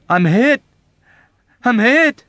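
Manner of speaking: very high, loud wounded cry; anguished cry. Acted. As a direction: cry out in terror. Yossarian wounded, crying out.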